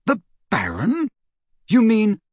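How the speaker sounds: high, astonished question; shocked. Acted. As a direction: ask haltingly in rising disbelief. Shocked to learn who killed her.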